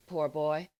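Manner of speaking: ordinary delivery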